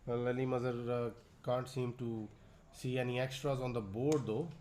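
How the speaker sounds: ordinary talk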